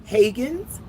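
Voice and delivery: Haggans asked with a long a like hay; trying a name. Shown as a demonstration. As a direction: Haggans as HAY-ganz, rising. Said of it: "I hate pronouncing people's name wrong".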